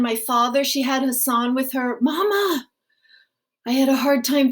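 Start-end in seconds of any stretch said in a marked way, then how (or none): 2.0-2.7 put-on high, calling child voice; child calling Mama. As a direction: call out for your mother.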